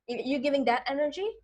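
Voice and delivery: ordinary talk, nothing marked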